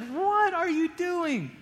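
high, slow disbelieving cry at himself; exasperated self-rebuke. Retold or imitated. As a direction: scold yourself in shocked disbelief. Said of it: "I was like".

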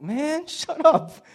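high laughing mock protest; mock exasperation. Pastor mocking his own reaction to Piper, high and laughing. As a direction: scoff at it with a laugh. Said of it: "I'm like".

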